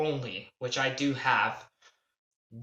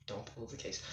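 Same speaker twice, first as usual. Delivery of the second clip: real aside, lower and much softer; muttered aside; 6 semitones lower, 20 dB quieter than usual. Real, not acted. Telling little sister off camera not to pull the case, muttered. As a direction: drop it away from the mic.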